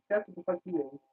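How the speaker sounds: normal talking voice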